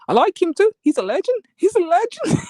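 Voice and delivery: high, laughing mimicry of Captain; mock Captain. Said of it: "But he's like".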